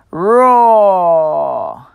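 dinosaur roar. Acted. Reading to children, a loud T. rex mummy roar. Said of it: "Listen to my mummy's call".

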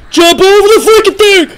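high, loud panicked yell at the screen. Real, not acted. Streamer yelling at the game character to jump, panicked and loud.

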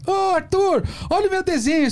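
put-on high, bright kid voice showing off a drawing; child's voice. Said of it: "Toda criança no desenho vem mostrar".

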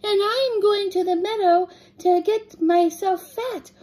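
tiny, high-pitched little goat; very small voice. A narrator's reading. Littlest goat answers the troll under the bridge, very small high voice. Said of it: "in his very small voice".

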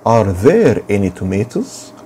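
slow, clear model question; slow teacher drill; slow model reading. Shown as a demonstration. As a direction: spell it out slowly for the class. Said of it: "teacher models question slowly".

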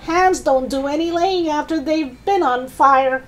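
put-on high old-woman voice; shouting Grandma. Grandma tells George about burnt hens, high-pitched calling. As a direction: lecture from the roof in a high voice. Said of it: "Grandma shouted".

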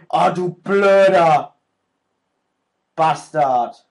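loud, slow drawn-out insult; angry curse. Real, not acted. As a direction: drag out the curse in frustration. Cursing an enemy again.

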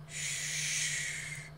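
soft, unvoiced shush. A narrator's reading. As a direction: gently hush someone panicking. Gray wolf soothes the gagged narrator.

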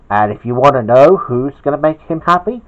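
usual speaking voice

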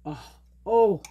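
usual speaking voice

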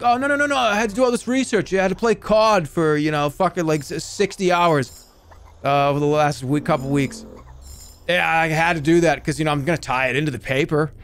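loud, high-pitched excuses in a put-on Boston accent; hammed-up Boston student protest; mock Boston student. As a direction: plead your case as a middle-aged Boston guy. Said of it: "why they would have that accent".

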